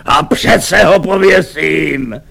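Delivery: slow, high-pitched defiant cry; shouting. A narrator's reading. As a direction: cry it out slowly as a stubborn vow. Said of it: "křičel".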